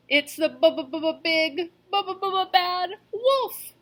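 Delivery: high, slow stuttering fright; teeth chattering. A narrator's reading. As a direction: stammer through chattering teeth. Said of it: "Boris chattered".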